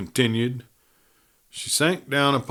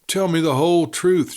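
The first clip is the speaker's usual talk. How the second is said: pleading; 6 semitones higher, 4 dB louder than usual. A narrator's reading. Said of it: "she pleaded".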